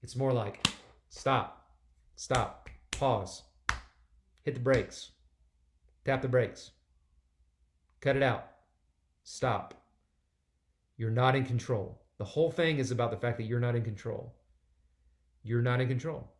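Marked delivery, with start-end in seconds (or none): 1.1-12.0 louder, firm stop commands; preacher ordering a halt, emphatic. Shown as a demonstration. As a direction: slam the brakes on the listener. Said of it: "It feels much more emphatic".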